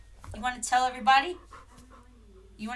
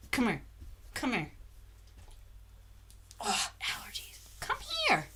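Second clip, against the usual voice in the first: whispering; 3 semitones higher, 11 dB quieter than usual. Real, not acted. Whispering to the dog.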